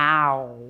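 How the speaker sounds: "Ow" as a low, drawn-out gliding vowel; ow sound. Shown as a demonstration. Teacher repeating the sound for a pupil, ow sound. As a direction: model "Ow" alone, from ah into oo. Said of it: "And the ow sound".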